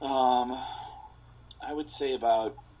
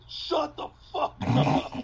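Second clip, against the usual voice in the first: much higher, louder, slow mock heckler; 6 dB louder, slower than usual. Bar heckler telling the host to shut up, hammed-up and higher. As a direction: bark it from the bar. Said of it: "a guy at the bar said".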